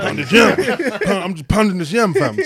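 high voice cracking into laughter; mock the auntie while laughing; laughing auntie impression. Auntie boasting about pounding yam, laughing high voice.

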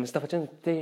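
sniffly just after blowing nose; nose still stuffed. Real, not acted. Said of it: "devo soffiarmi il naso".

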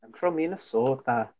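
playful Minnesotan with rounded, stretched O's; Minnesota accent. Retold or imitated. Joining in the friend's impression, mock Minnesota accent. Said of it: "you get to Minnesota".

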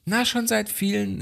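a hint of a polite bank clerk; mock bank agent. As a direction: play the fussy hotline agent. Bank agent voiced in story.

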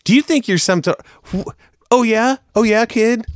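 high-pitched mock outrage; indignant comeback. Dad answering his kid's criticism, mock indignant. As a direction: sputter it, pitch jumping up.

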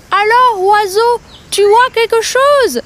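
high, strained voice through a wide-open jaw; talking with mouth open. Acted. Crocodile asking the bird with jaws wide open, strained. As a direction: speak as if your mouth is held open. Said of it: "beaucoup de mal à s'exprimer avec la gueule ouverte".

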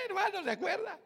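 high laugh into the question; laughing as he asks brother to remember; preacher chuckling at his tortilla story. Real, not acted. Laughs at his own joke.

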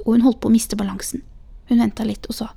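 ordinary delivery, nothing marked

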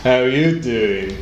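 slow, drawn-out "How you doing?" in an English pickup-line voice; Joey's smooth flirty greeting, said very slowly; Joey impression. Joey catchphrase during Friends chat.

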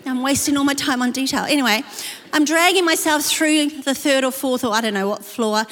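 usual speaking voice

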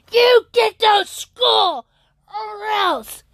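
high, slow shouted threat; high, drawn-out shout of an angry dad; retold father's scream. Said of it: "I hear screaming".

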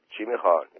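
usual speaking voice

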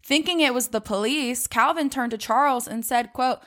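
usual speaking voice

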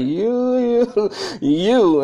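high, rising accusing "you"; accusing outburst. Retold or imitated. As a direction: point at him and call him out, pitch climbing. Attendant accusing Jay on plane.

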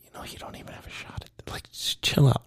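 conspiratorial whisper to a parent. Retold or imitated. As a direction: whisper it like a secret just between us. Advice to over-the-top parent.

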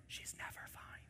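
hushed secret aside; confiding to the men in a whisper. Real, not acted. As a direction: lean in and whisper it.

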